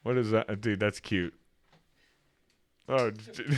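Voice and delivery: usual speaking voice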